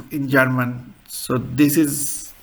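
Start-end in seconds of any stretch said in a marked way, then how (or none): none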